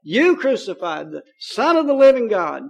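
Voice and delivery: forceful preaching, each "you" punched loud; emphatic charge, building to loud jabs; retold accusation. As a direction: imitate an apostle accusing a crowd. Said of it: "He pointed his fingers at these people".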